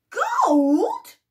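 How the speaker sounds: high, loud astonished question; amazed cry. Acted. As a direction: gasp it out in disbelief. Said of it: "cried Jack's mother".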